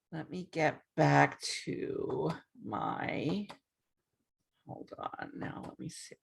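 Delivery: very soft, barely voiced aside; under the breath, almost a whisper; talking to oneself. Real, not acted. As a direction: murmur it to yourself while searching. Fumbling with screen share, talking to herself.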